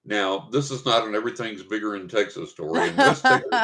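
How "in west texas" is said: mock Texan. Mock Texas boast.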